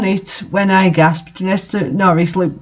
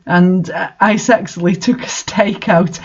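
ordinary talk

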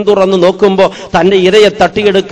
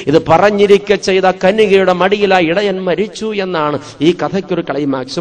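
normal talking voice

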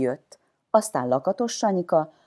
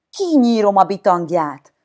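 loud, higher-pitched threat; Pipacs Vili yelling; 5 semitones higher, 7 dB louder than usual. A narrator's reading. Boy imagining himself pirate king threatens the intruder, yelling. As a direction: yell it like a swaggering pirate king. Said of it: "üvöltötte Pipacs Vili".

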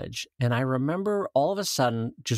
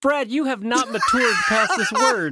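laughing; 11 semitones higher, 6 dB louder than usual. Real, not acted. Teasing loudly while laughing.

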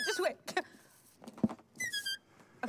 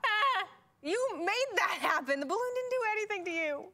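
shocked protest; 7 semitones higher than usual. Real, not acted. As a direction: cry out at the popped balloon. Protesting the popped balloon.

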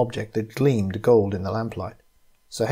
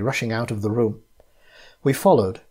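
ordinary delivery, nothing marked

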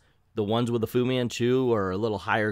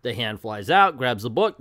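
ordinary delivery

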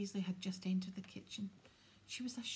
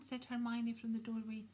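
usual speaking voice

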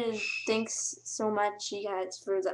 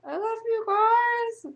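tearful; 12 semitones higher, 7 dB louder, slower than usual. Real, not acted. Emotional goodbye to subscribers.

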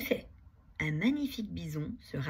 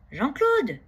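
high call from up in the tree; shout; 7 semitones higher, 9 dB louder than usual. Acted. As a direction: call out loud to your friend below. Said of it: "crie-t-il". (crié fort depuis l'arbre)

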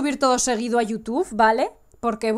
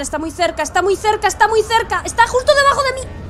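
rising panic; 9 semitones higher than usual. Real, not acted. Player seeing the monster right below, growing panic. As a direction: panic builds as it closes in.

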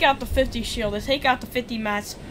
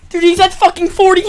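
screaming high and loud; furious shout; 10 semitones higher, 14 dB louder than usual. Real, not acted. Streamer raging when his shot didn't hit, furious shout. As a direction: yell at the game for cheating you.

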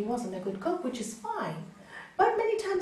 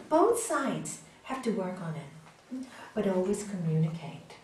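normal talking voice

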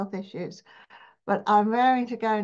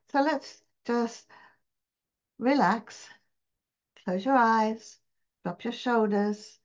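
guided meditation. Acted. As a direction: guide listeners into relaxing, phrase by phrase, unhurried.

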